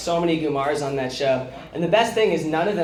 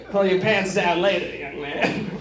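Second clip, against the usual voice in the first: Bill Cosby impression; 4 semitones higher than usual. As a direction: drag it out like Cosby. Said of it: "my Bill Cosby".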